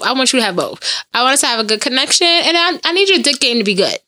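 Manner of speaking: ordinary talk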